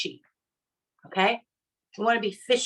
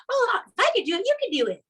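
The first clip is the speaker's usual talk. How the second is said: gushing mock quote; 9 semitones higher, faster than usual. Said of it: "You're not just saying like".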